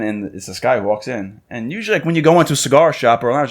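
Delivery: ordinary delivery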